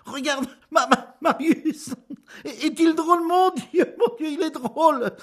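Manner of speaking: high, loud, helpless laughter through words; gasping it out between laughs; laughing. Acted. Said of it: "appelant sa femme, ne pouvant plus parler".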